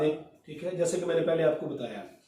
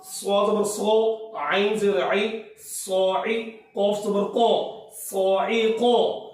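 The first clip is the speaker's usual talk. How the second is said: slow demo reading; much slower than usual. Shown as a demonstration. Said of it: "میں آخری line پڑھتا ہوں".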